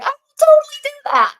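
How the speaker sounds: high, loud burst of enthusiasm; eager agreement. Retold or imitated. Replying to a request to film her workday, excited. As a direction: jump at the idea, voice shooting up.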